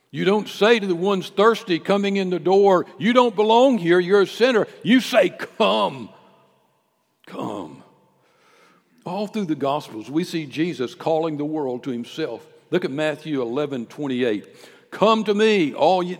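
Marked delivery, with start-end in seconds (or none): 0.1-6.1 loud, rising sermon cry; preaching at full force. Real, not acted. 7.3-7.8 gentle invitation. Acted. 14.9-16.2 loud, rising sermon cry; preaching at full force. Real, not acted. Preaching Jesus' words.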